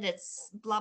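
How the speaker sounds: ordinary talk, nothing marked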